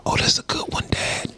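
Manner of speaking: soft whisper to the sky; talking softly to his late dad; whispered aside. Retold or imitated. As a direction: breathe it as a private joke.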